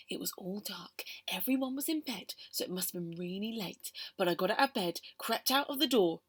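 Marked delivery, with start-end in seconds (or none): 0.1-3.9 whispered. Acted. Sneaking in the dark.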